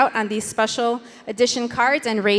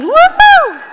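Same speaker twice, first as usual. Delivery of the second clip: excited cheer; 11 dB louder than usual. Real, not acted. Cheering paddles raised at auction.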